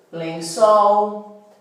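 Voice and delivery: colher, amor, lençol, avião, café, sofá, each with a held, stressed final syllable; teacher's word list, "colher" to "sofá", endings stressed and drawn out; stress on last syllable. Shown as a demonstration. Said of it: "a última sílaba mais forte".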